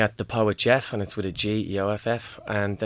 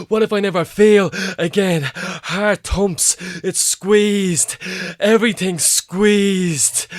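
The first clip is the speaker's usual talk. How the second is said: poem recital; 8 semitones higher, 7 dB louder, slower than usual. Acted. Performs poem Panic Attack.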